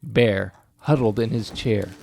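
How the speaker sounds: ordinary delivery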